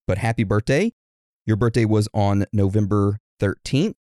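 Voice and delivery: normal talking voice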